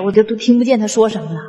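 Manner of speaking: ordinary talk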